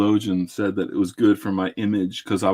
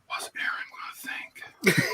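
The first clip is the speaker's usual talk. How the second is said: whisper; 10 dB quieter than usual. Pretend. Imagined fearful inner thought.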